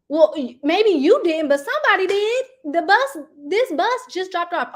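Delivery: loud, high exasperated pushback; heated argument. Retold or imitated. Mom arguing on the phone with the school admin, loud and exasperated. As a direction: push back hard, voice rising.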